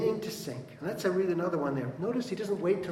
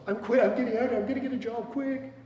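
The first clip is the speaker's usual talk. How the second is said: high, loud panicked outburst; mock panic, blurting it out; hammed-up frantic "I'm quitting"; 9 semitones higher, 6 dB louder than usual. Panicked pastor quitting in a retold scene.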